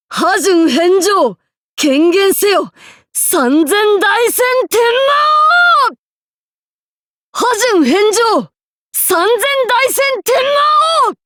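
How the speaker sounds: high, loud roared attack call; full-force battle roar. Acted. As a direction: bellow the attack name with everything. Ultimate attack calls.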